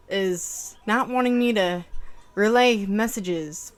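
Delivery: ordinary talk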